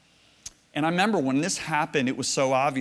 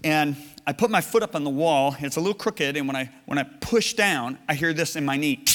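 usual speaking voice

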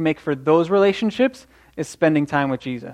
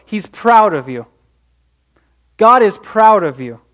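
rising proclamation; 4 semitones higher, 5 dB louder than usual. Acted. Building declaration.

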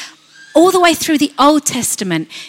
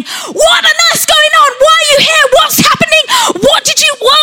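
very high, loud panicked shriek; screaming fear; 5 dB louder than usual. Acted. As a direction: scream questions like fear in someone's ear. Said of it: "fear starts screaming in your ear".

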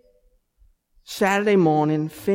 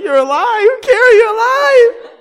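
much higher, louder, laughing-crying mock relief; relieved joy; 10 dB louder than usual. Little girl seeing her sister is fine: tearful laughing joy. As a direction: squeal it through laughter and tears. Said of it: "She was like".

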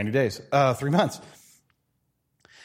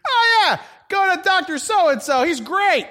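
much higher, loud overeager gush; fake enthusiasm; 12 semitones higher, 5 dB louder, slower than usual. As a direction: oversell a doctor. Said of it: "recommend a doctor".

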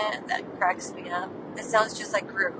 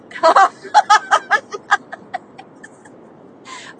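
loud, high burst of laughter; big helpless laugh, tailing off; cracking up; 10 semitones higher, 11 dB louder than usual. Real, not acted. Laughing at partner's rolled R attempt, cracking up.

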